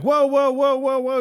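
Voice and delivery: high, loud mock alarm; mock alarmed shout. Retelling a movie scene to co-host, high loud mock alarm.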